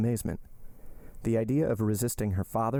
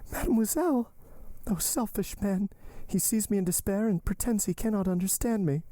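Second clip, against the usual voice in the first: high-pitched, despairing reproach; Valentine's cry; 12 semitones higher than usual. A narrator's reading. Valentine accusing her selfish lover, despairing cry. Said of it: "cried Valentine".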